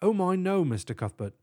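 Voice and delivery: put-on high "Oh my, no" in a British accent; British accent, starting high then settling; declaring British professor. A narrator's reading. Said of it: "Tutwiler declared".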